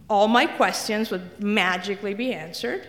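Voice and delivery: ordinary delivery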